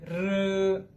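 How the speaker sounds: drawn-out "र", said very slowly; pronunciation demo. Shown as a demonstration. Teacher slowly models "र" for students, drawn out. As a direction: stretch the "र" slowly so students copy it. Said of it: "देखिए".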